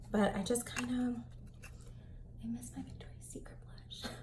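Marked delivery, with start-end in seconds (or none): 2.4-4.1 soft whispered confession; wistful whisper. Real, not acted. Wistful confession about old blush.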